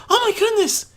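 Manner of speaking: high, loud startled cry of embarrassment; shocked gasp. Acted. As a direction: blurt it out, flustered and shocked. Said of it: "she gasped out in shock and embarrassment".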